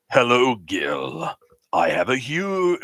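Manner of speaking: slow, big announcer delivery; game show host voice. Acted. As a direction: welcome them like a TV host. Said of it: "gonna get the game show host voice".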